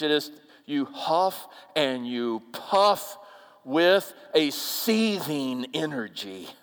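ordinary talk, nothing marked

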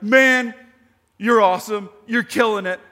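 loud, excited imagined praise from God; mock praise. Paul imagining God praising him, loud and excited. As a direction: hype him up like he's winning.

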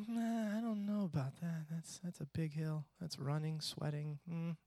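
put-on soft, doubtful mumble as a kid backing out; reluctant kid. Reluctant son eyeing the hill.

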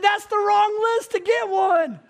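very high, loud, slow protest; mock exasperation. Dad answering his kids, loudly mock-exasperated. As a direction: wail it in comic dismay.

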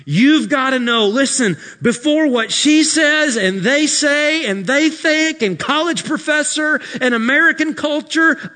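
loud, high preaching climax; full-force preaching. Acted. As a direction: thunder it to the congregation.

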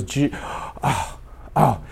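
acting out being overcome by tears; imitated crying. Pastor calling on Jesus in tears, imitated breathy sobs. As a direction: choke on the syllable, then gasp out soft breaths. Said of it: "he starts crying".

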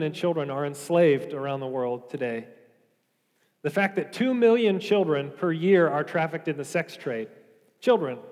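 ordinary talk

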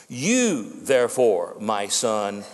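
"You" pitched well above the rest, then a pause; stress on YOU. Shown as a demonstration. Preacher reading Paul's charge to Timothy, emphatic YOU. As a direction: set "You" apart and let the rest drop low. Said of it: "is what we call emphatic".

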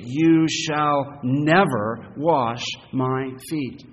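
very slow and loud, each word weighted; emphatic refusal. Acted. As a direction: dig in on "never", word by word. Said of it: "it's so emphatic".

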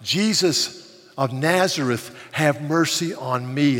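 slow, loud desperate plea. Acted. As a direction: call out to Jesus with all your strength. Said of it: "cried out all the louder".

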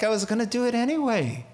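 quick light divine shrug; the Lord, breezy. Retold or imitated. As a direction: say it like God shrugging. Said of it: "The Lord is like".

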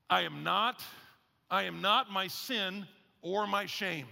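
slow, with weight on "not", "sin" and "shame"; measured vow, stressing "not"; emphatic declaration. Shown as a demonstration. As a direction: hit "not" firmly, drawing out the phrase. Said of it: "try to emphasize the correct ones".

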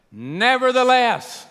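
loud, high, stretched-out call; emphatic proclamation. Retold or imitated. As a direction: hold the word long and ring it out. Preacher calls out the answer word.

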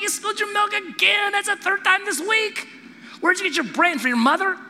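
high-pitched angry parental rant; hammed-up outburst at a clumsy kid; blowing up. Dad at dinner to child who spilled the milk, blowing up. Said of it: "you blow up".

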